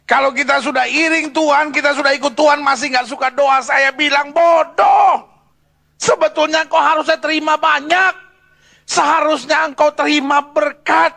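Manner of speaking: forceful preaching. Real, not acted. Preacher rebuking believers who will not pray, forceful exhortation. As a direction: press each phrase home to the congregation.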